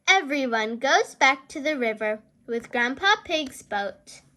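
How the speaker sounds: ordinary delivery, nothing marked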